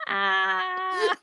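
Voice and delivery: high laughing vampire wail; hammed-up burning vampire cry; vampire impression. Shown as a demonstration. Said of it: "That's my vampire impression".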